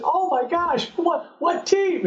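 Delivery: high, excited surprise; retold burst of excitement; excited gasp. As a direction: blurt it out thrilled and high. Excited reaction to being drafted.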